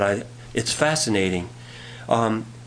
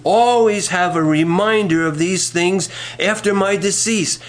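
raised, projected Bible reading; preacher proclaiming a verse in higher pitch; emphatic scripture reading; 5 semitones higher than usual. Acted. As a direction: read it out firmly to the congregation. Reads scripture aloud to congregation.

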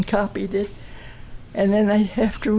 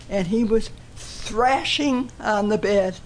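ordinary delivery

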